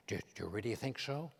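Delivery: imitating Reagan, low and soft; hushed, folksy Reagan impression; Ronald Reagan. Reagan confiding to the comedian about his impressions, low and soft. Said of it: "Reagan asks".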